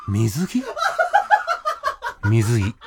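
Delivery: lightly voiced lead hero, stunned; 宗太郎の声. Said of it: "宗太郎の声だよ、". (低めに軽く演じる主人公の驚き声)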